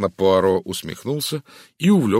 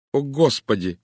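higher startled cry; exclaiming in alarm; 7 semitones higher, 4 dB louder than usual. A narrator's reading. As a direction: gasp it out in sudden dismay. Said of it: "воскликнула Тереза".

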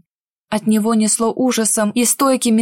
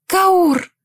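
calling out; 8 semitones higher than usual. Acted. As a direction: cry his name out. Heroine calls to captive Kaur.

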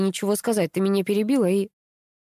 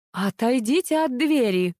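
bellowing; 4 semitones higher, slower than usual. A narrator's reading. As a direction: order them away with drawn-out words. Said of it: "орала Капа".

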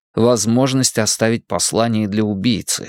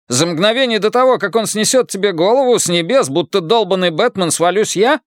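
higher, mocking Irish brogue; sarcastic Irish accent; 8 semitones higher than usual. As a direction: lay out his plan with Irish sarcasm. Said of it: "Ирландский акцент работал на всю катушку".

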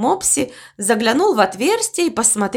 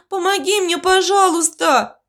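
pleading; 9 semitones higher than usual. A narrator's reading. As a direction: beg in a high little voice. Frightened guinea pig begs for help.

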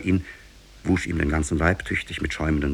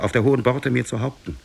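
higher-pitched reply, mildly raised; calling back; 7 semitones higher, 4 dB louder than usual. A narrator's reading. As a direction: keep calling the answer over. Continues the called-back answer. (mit leicht erhobener, hoher Stimme zurückrufend)

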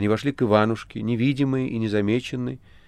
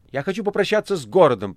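much higher, louder cry; shouting over thunder; 10 semitones higher, 5 dB louder than usual. A narrator's reading. The master shouting to Azazello over thunder. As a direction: shout it over the galloping storm. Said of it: "прокричал мастер".

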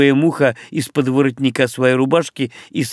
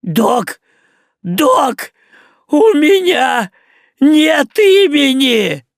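very high, loud, slow panicked yell; Мух realising he has no name, shrill yell; 12 semitones higher, 6 dB louder, much slower than usual. Acted. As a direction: scream for the doc in alarm. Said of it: "а потом заорал".

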